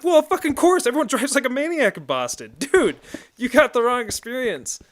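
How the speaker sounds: high, loud mock-outraged yelling; exasperated outburst. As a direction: exclaim it in comic exasperation. Acted exasperated outburst about Boston drivers.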